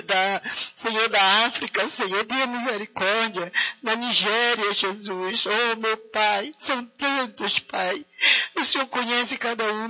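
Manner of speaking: usual speaking voice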